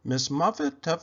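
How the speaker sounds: plain reading voice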